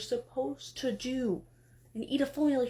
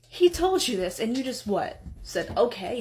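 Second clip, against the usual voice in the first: louder, exasperated berating; exasperated scolding; 6 dB louder than usual. Acted. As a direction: scold him in disbelief. Exasperated wife berating husband.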